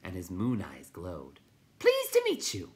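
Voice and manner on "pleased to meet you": loud, very high creature greeting; excited creature. A narrator's reading. Night Hob greets the newcomer, high and loud.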